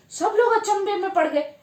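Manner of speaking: usual speaking voice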